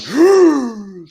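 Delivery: loud, enormous intake of breath; huge gasp. Acted. Game master shows a monster's breath, huge gasp. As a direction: suck in air like a god. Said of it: "takes a breath in that you imagine".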